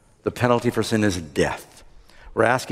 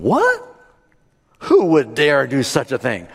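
high-leaping, indignant "what?" into disbelief; shocked outrage; 3 semitones higher, 4 dB louder than usual. Acted. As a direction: play the king aghast at the affront. King reacts in shocked outrage.

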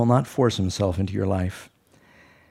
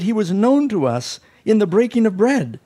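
excited testimony; 9 semitones higher, 4 dB louder than usual. Retold or imitated. Disciples tell the apostles.

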